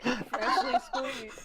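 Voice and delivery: slow drawn-out voice. Pretend. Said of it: "She's like".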